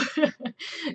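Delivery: a quick bright laugh; short high laugh. Real, not acted. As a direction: laugh at yourself briefly. Laughs about not hitting goal.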